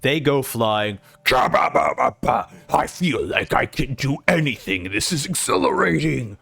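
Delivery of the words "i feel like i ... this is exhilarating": gloating demon. Acted. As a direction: relish the new power, unhurried. Evil Broggy gloating.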